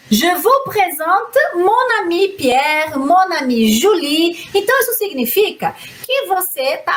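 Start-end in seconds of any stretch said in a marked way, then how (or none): 0.1-4.5 slow, careful model reading; slow model sentence. Shown as a demonstration. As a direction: read the example slowly for learners. (leitura lenta e cuidadosa do exemplo)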